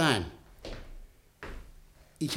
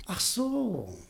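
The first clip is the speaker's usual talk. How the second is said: whispered aside; 3 semitones higher, 8 dB quieter than usual. Acted. Butschan reacts to secret.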